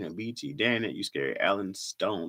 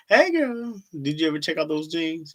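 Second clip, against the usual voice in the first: put-on girly voice; 6 semitones higher, 3 dB louder than usual. Reading viewer chat in a voice.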